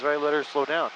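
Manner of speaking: ordinary talk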